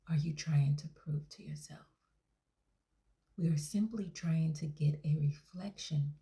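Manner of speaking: whispered counselling voice; therapist whisper. Acted. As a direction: hush every word like a calming counsellor. Therapist whispers throughout session.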